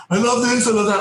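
high, gushing fan rave. Retold or imitated. Imagined fan gushing at Patrick Stewart. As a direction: gush like an overeager fan. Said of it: "instead of going".

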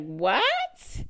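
high, rising "what?"; shocked disbelief. Retold or imitated. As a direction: shoot up into an astonished question. Retelling shock at the charges.

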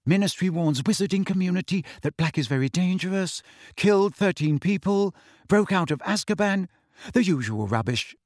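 ordinary delivery

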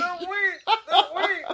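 very high-pitched echo; mock echo. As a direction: squeak the words as a playful echo. Co-host keeps up the mock echo.

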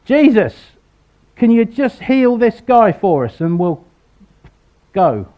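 high, loud call down from above; mock shout. Friends on the roof shouting down to Jesus, loud high call. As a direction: call down through the hole, pleading. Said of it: "shout down and say".

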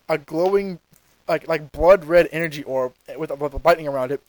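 normal talking voice

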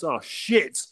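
half-hearted yelp of someone slipping; mock shout. Third man slips off cliff, mock shout. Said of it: "slips and shouts".

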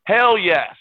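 retold eager acceptance. Ball boy accepting the star's shoes, retold eager acceptance. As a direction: jump at the offer, acting it out.

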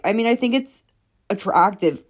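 usual speaking voice

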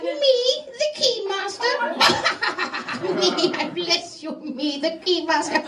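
mock creepy hunchback; hunchback keymaster. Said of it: "He's like".

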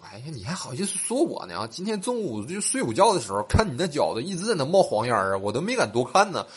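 high, swooping mock outrage; indignant retort. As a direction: throw the accusation back, hammed up. Acted indignant comeback about smelly feet.

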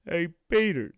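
very loud, punchy character call; cartoon-style impression. Shown as a demonstration. Showing a friend which impression to do, loud character call. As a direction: call the name out loud in a put-on voice. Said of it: "can you do, like".

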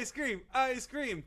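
high, soft repeated chant; childlike excitement. Acted. Man bribed to behave, chanting softly in high glee. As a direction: keep chanting it high, under your breath. Phil keeps chanting for the treat.

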